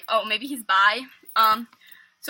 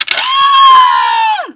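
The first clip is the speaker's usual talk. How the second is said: long, very high scream; loud scream; 12 dB louder than usual. Retold or imitated. Friends reacting to him putting a shirt on, long scream. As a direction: let out a sustained mock scream.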